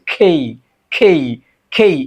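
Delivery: "খেই" with a puffed k, gliding to i; between ক and খ. Shown as a demonstration. Teacher explaining the sound between two letters, careful pronunciation. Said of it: "ক এবং খ এর মাঝামাঝি একটা উচ্চারণ".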